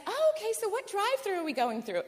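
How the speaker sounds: naive friend. Retold or imitated. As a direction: sound innocently oblivious.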